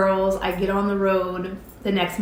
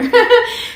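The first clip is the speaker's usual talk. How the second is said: high, loud laugh; big loud burst of laughter; 13 dB louder than usual. Real, not acted. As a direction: crack up at the memory. Laughs about the salary number.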